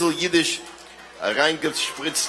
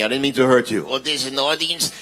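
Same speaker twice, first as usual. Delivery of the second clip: ordinary delivery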